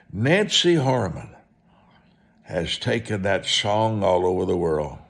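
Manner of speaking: usual speaking voice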